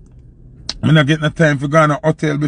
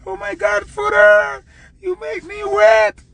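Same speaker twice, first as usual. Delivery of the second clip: much higher, excited screaming fan voice; screaming girls in the crowd; slower than usual. Retold or imitated. Girls in the crowd screaming at the singer, high and excited. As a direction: squeal it like a fan losing it at the show. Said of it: "the girl them a sing and a scream".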